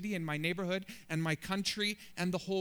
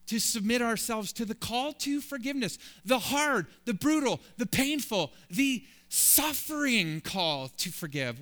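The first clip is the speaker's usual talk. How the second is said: rising louder on each word, then slowing; building preacher's cry; 6 semitones higher, 5 dB louder than usual. Acted. Preacher pressing the congregation on forgiveness, building to a cry. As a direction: pile up the hard words like a preacher.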